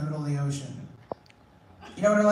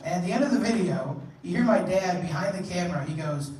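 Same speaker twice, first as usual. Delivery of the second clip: normal talking voice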